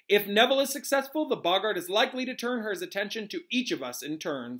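emphatic, animated professor; Professor Lupin teaching. Acted. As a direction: lecture the class with gusto.

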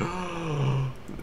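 a hammed-up soft gasp for breath; mock gasp. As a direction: gasp like an overacting actor. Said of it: "he's like".

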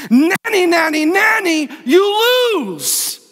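high, playground-style mock taunt; gloating taunt. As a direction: rub it in like a triumphant kid. Childish taunt at defeated devil.

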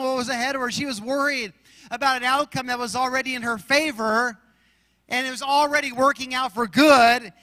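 preacher's cadence, quotes rolled out with weight; preaching. Acted. Pastor preaching on worry and supplication, fervent. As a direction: drive the scripture home to the church.

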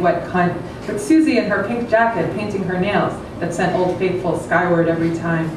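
plain reading voice

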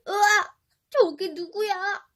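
loud high cry of shock; shocked scream. Acted. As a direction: recoil from the mirror. Prince shocked at mirror. (깜짝 놀란 비명)

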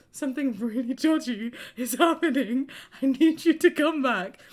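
high, loud, frantic begging; pleading with her boyfriend, high and frightened; panicked plea. Retold or imitated. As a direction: beg him to come back, half laughing at it now. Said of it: "I'd broken down crying".

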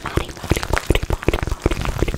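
whispered name repeated as an ASMR trigger; repeated trigger word. Shown as a demonstration.

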